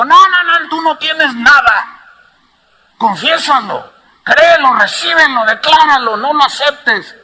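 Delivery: imitating the pastor, commanding and loud; pastor's rebuke. Pastor quoted rebuking the sick man. (imitando al pastor, fuerte y exigente)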